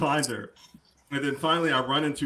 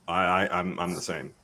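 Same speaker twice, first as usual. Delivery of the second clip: usual speaking voice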